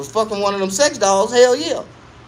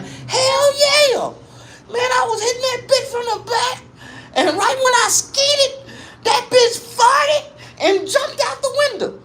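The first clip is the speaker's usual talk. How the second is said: put-on high wheezy old man; slower than usual. OG retells the doll.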